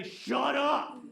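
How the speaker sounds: loud, blunt "Shut up"; firm shut up. Shown as a demonstration. Preacher showing the congregation how to stop gossip, loud and blunt. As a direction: cut the gossip off flat. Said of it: "just to say".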